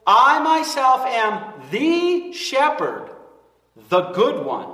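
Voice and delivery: "I myself am the shepherd" slow, with THE drawn out; emphatic quote. Acted. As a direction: lean hard and slowly on "the", then set "the good one" apart. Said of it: "but it's very emphatic".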